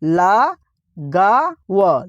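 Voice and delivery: syllable-by-syllable demo. Shown as a demonstration. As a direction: break लगाव into ला, गा, व, very slowly. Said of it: "क्या दोस्तों?".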